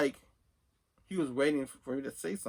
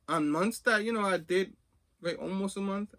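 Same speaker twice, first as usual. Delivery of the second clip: ordinary talk, nothing marked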